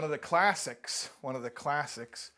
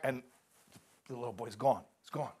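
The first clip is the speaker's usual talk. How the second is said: ordinary talk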